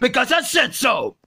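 ordinary delivery

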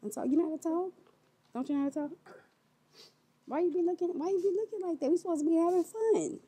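mock scolding. Parent scolding child prank.

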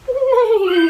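high, loud neigh; whinny like a horse. Acted. Parent voicing the horse for her child, loud high neigh. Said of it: "said the horse".

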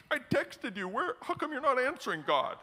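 high, pestering complaint; hammed-up indignant questioning of God; mock complaining believer. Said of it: "We're like".

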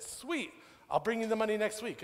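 high-pitched, quick imitation of a pleased friend; breezy, high-voiced promise; delighted acceptance. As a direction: jump up high with mock delight. Friend happily accepts the deal.

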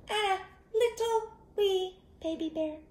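high squeaky little voice; high wee baby bear voice; tiny baby bear voice. A narrator's reading. Teacher voicing baby bear.

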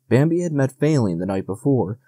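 ordinary delivery

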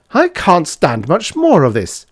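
emphatic exasperated rage; furious roar. A narrator's reading. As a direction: roar it out at the end of your patience. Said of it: "roared von Hartmann furiously".

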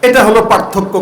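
normal talking voice

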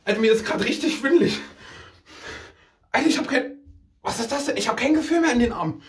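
out of breath. Real, not acted. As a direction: gasp the words out after exertion. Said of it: "Wie kann man denn ... so rumhecheln".

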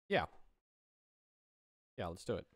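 usual speaking voice

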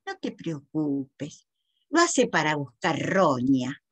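low, slow character voice; reassuring friend. A narrator's reading. Sarita telling a friend not to worry, low slow reassurance.

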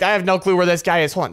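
ordinary delivery

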